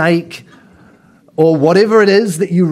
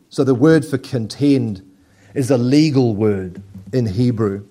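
normal talking voice

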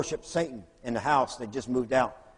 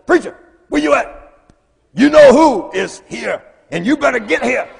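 urgent radio call; 8 semitones higher, 10 dB louder than usual. Retold or imitated. Walter calling over truck radio.

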